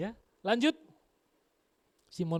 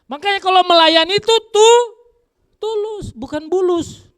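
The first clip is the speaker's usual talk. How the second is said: preaching exhortation; 14 semitones higher, 11 dB louder than usual. Real, not acted.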